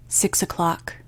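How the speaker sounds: ordinary delivery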